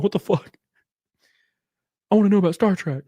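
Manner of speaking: high-pitched cartoon character voice; high, indignant skit impression; Stewie. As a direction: squawk it high, exasperated at the off-topic questions. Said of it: "when Stewie's wanting to ask a question".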